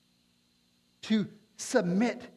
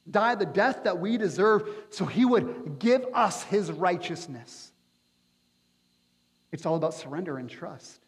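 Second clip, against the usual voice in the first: ordinary talk, nothing marked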